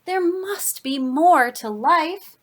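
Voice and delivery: put-on dramatic fed-up declaration for children; grumbling complaint. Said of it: "it grumbled".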